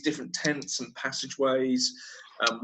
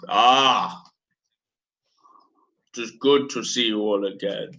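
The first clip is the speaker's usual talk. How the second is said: a lightly voiced Hutt accent, unhurried and grand; bad Hutt accent; 2 semitones lower than usual. Crime lord Boga greets the crew, lightly voiced bad Hutt accent. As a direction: greet them as a Hutt crime lord would, only a hint of it. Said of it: "This is my bad Hutt accent".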